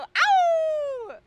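loud high howl; wolf howl. Shown as a demonstration. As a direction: howl it out at full voice. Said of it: "Just go".

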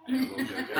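high falsetto mock of a mate saying it'll be okay; mock comforting friend. Friends mock-comforting him with a beer.

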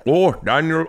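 put-on trying out a Scottish accent; attempted Scottish accent. Friend testing the accent before restarting, tentative Scottish. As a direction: feel for a mouth-full-of-water Scottish sound. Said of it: "put water in your mouth to do it".

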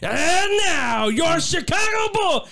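high, slow shouted lineup call; groggy announcer voice. Retold or imitated. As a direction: growl it out like the arena announcer. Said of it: "You gotta get that groggy voice that that man had".

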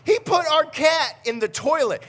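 high, loud comic disbelief; incredulous outrage. Retold or imitated. Dad telling how his son dunked the cat, incredulous. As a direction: exclaim it as an appalled punchline. Punchline of toddler story.

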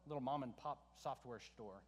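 low, soft deadpan sarcasm; sarcastic. Real, not acted. Preacher jokes about a very large company, sarcastic. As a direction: drop it low and understate it drily. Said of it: "I'm being sarcastic".

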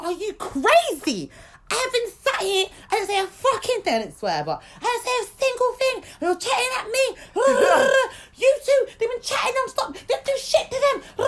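high, loud outraged yelling at the teacher; retold screaming. Girl screaming at the substitute teacher over the unfair blame, outraged. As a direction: scream it like you can't believe the injustice. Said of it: "I started screaming".

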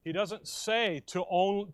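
ordinary talk, nothing marked

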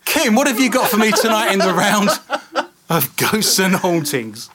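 high, loud, laughing. Real, not acted. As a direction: laugh while changing topic. Laughing on into the next segment.

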